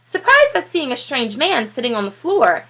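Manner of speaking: ordinary delivery, nothing marked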